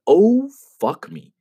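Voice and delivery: high, drawn-out amazement; floored, slow exclamation; astonished. Retold or imitated. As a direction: stretch it out high, stunned by what you heard. Teller reacts to unexpectedly good English.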